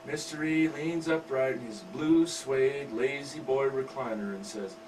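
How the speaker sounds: ordinary delivery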